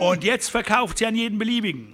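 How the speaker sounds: bitter reply; bitterer Rancher. A narrator's reading. Rancher voiced. (höher, bitter)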